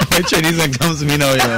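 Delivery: Trinidadian accent. Shown as a demonstration. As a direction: keep the Trinidadian accent going. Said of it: "Give us a peek".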